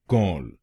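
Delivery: कॉल said alone with an open aw vowel; syllable demo. Shown as a demonstration. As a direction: model the syllable कॉल for learners. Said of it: "तीसरे शब्दांश को बोलिए कॉल". (शब्दांश कॉल अलग से बोलें)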